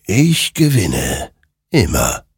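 slow, weighty, mildly otherworldly; lightly voiced creature. A narrator's reading. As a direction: sink in pitch word by word. The creature answers. (langsame, getragene Stimme der Kreatur)

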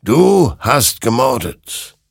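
weighty, damning reproach; accusing. Acted. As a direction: pronounce the accusation with drawn-out force. Creature accuses Hektor of murder.

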